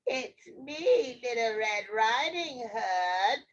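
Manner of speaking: slow, put-on girl voice; a wolf badly posing as a child; wolf's little-girl voice. Wolf pretends to be Red, fake girl voice. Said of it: "in his best little girl voice".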